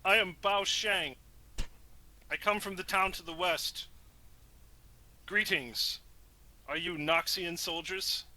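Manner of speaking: courteous stranger meeting armed men; in-character Bao Sheng. Acted. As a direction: speak up politely as a wary traveller. Player voices Bao Sheng in character.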